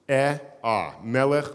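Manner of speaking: "Eh" then "ah", each set apart; isolated vowels, "eh" and "ah"; demonstrating two vowels. Shown as a demonstration. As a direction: say EH, then open to AH, as separate sounds. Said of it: "I'm doing the vowels".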